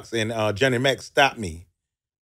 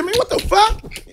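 much higher, startled mock exclaim; baffled outburst. Jamie reacting to the odd whisper, high startled exclaim. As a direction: react as if totally thrown. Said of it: "And he said".

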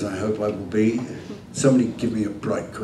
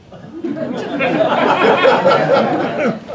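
disbelieving laugh; 4 dB louder than usual. Real, not acted. Told he's won, disbelief.

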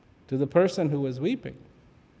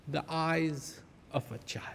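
whisper; 3 semitones lower, 14 dB quieter than usual. Acted. Whispered key phrase in teaching.

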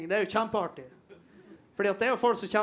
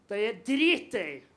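ordinary talk, nothing marked

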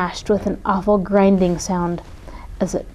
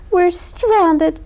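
despairing cry; 8 semitones higher than usual. A narrator's reading. Columbine stranded at sea, crying in despair. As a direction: cry out in panic. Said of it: "cried Columbine".

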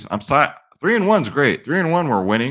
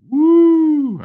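long, high "wooo!"; loud, drawn-out celebratory howl; showy whoop; 8 dB louder than usual. Pretend. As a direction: whoop like a boasting wrestling champ. Wrestling-style brag about title belt.

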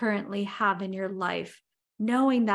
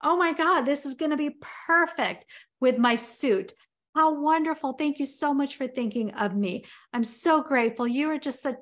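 high, gushing thanks that settle toward the end; husband thanking the best wife in the world; overjoyed husband; 3 semitones higher than usual. Retold or imitated. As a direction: pour out the thanks like a delighted husband. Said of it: "He's like".

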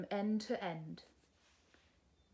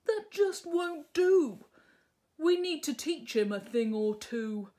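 indignant dragon; 7 semitones higher, 8 dB louder than usual. Acted. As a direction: vow to teach him.

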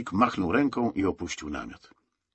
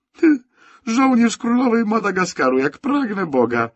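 much higher, louder teasing jeer; mocking; 14 semitones higher, 9 dB louder than usual. A narrator's reading. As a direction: ridicule him with exaggerated pomp. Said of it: "naigrywał się kapral".

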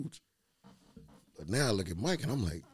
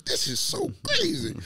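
mock incredulous. Quoting himself in a story.

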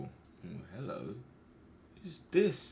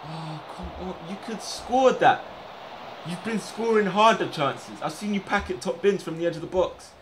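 frustrated shout; 10 semitones higher, 6 dB louder than usual. Real, not acted. Streamer scolding his striker for a miss, frustrated shout. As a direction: chew out the striker.

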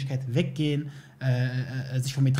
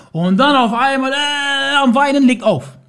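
outraged retelling; 9 semitones higher, 7 dB louder, slower than usual. Real, not acted. Retelling her crying, outraged.

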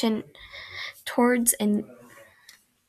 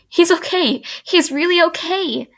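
relieved sob; 7 semitones higher than usual. Acted.

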